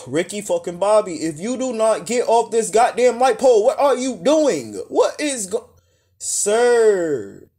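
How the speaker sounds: high, loud mock scolding; exasperated calling out. To a man climbing a light pole, exasperated calling out. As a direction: holler at a reckless daredevil in disbelief.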